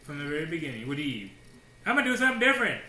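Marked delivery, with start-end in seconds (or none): 1.9-2.8 defiant declaration. Acted. Quoting a defiant attitude.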